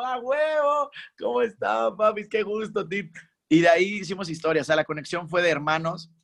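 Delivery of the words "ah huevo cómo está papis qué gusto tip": high, hyped friendly greeting; hearty greeting. Retold or imitated. Singer greeting the producers after dancing, high and hyped. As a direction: greet new friends all fired up.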